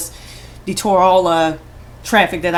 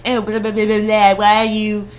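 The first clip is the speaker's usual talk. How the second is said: mocking whine; 2 semitones higher than usual. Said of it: "coming at me saying".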